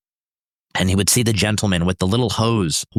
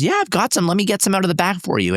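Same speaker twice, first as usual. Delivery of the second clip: put-on higher, cheery helpful girl; a light higher voice for the baker girl; lightly voiced young baker; 9 semitones higher, faster than usual. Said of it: "She goes".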